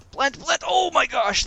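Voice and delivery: high, loud panicked cry; panicked yelp. Real, not acted. Gamer dodging a monster, panicked high yelp. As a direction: yelp in sudden alarm. Creeper about to blow him up.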